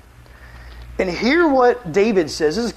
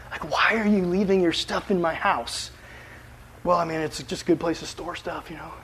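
soft, hushed mock exchange; quiet imagined back-and-forth; 2 semitones lower, 8 dB quieter than usual.

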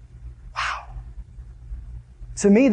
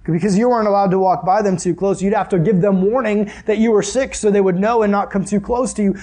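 normal talking voice

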